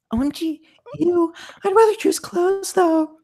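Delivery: very high put-on voice; girly friend. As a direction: squeak out a fussy reaction. Voices Emma's answer in game.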